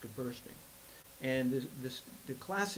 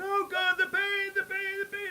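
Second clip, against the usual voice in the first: very high, loud moaning of pain; an old man in agony on his bed, high and loud; yelling in pain; 8 dB louder than usual. Retold or imitated. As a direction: bellow out the pain at the top of his lungs. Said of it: "yelling at the top of his lungs".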